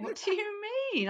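high indignant squeal; mock outrage. Real, not acted. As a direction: act scandalised.

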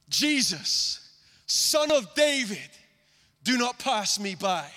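high, drawn-out plea to be heard; crying out. Acted. Blind beggar calling out to Jesus again, pleading cry. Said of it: "he cries out this".

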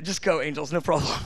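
calm, unbothered reassurance; voicing God. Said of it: "talk like that".

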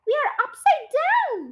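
very high astonished cry; acted shocked gasp. A narrator's reading. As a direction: exclaim it in high-pitched shock. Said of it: "gasped Mister Twit".

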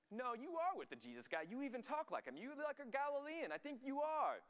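high-pitched mock accusation; accusing crowd. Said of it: "this whole crowd is kind of noticing".

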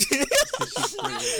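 very high, loud laughing squeal; slow, shrieking with laughter; cracking up. Real, not acted. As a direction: squeeze the words out through laughter. Repeats the joke, cracking up.

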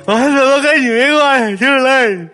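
high, loud wailing sobs; crying kid. Retold or imitated. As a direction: bawl it out like a wronged child.

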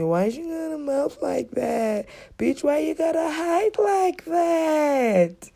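high, drawn-out mocking whine; exaggerated singsong mockery; mocking taunt. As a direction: stretch it out in a high, silly taunt. Mocking critic, then retorting likewise.

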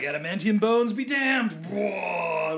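hammed-up battle cry while heaving; mock heroic roar. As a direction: play the strongman's big lift. Acting hero straining at lifting.

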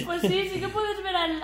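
high laugh running into speech. Real, not acted. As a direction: talk through the giggles. Laughing after the joke.

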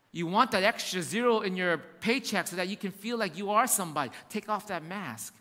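ordinary delivery

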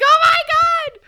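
high, loud panicked cry; panicked screaming. Pretend. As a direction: scream it out in mock terror. Acting the dude's panic.

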